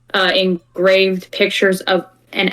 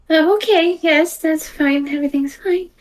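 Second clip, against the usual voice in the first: high flustered voice trailing off; flustered character; 9 semitones higher than usual. Acted. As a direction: say everything's fine as if it isn't. NPC flustered reassurance.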